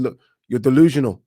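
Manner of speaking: put-on light Australian accent, loud and blunt. Boxer telling his beaten opponent off, light Australian accent. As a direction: quote the boxer in a slight Aussie accent. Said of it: "that was my Australian accent".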